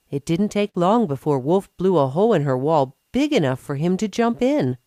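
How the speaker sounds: ordinary delivery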